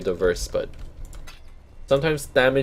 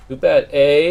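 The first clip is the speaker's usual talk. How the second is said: Canadian eh; 3 semitones higher, 7 dB louder than usual. Shown as a demonstration. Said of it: "can tell I'm Canadian".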